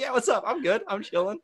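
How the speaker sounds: high, breezy unbothered reply; casual chill. Retold or imitated. Telling the class he's fine, casually chill. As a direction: act totally relaxed.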